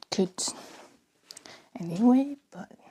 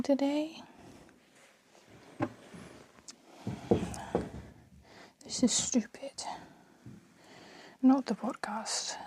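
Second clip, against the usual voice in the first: whispering. Real, not acted. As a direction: keep it hushed, mother in the next room. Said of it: "I don't know why I'm whispering so much".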